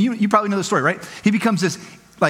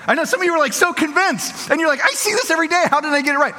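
teasing; 9 semitones higher than usual. Acted. Teasing convinced listeners.

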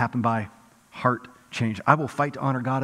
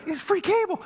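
mock incredulous; 13 semitones higher than usual. Imitating a skeptic, high mock disbelief. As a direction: act baffled that anyone refuses free cable. Mocking skeptics of refusing free cable.

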